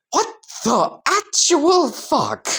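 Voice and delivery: high, slow, drawn-out disbelief; shocked outburst. Acted. Iida sees his legs become ducks, shocked outburst. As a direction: stretch each word in high-pitched shock.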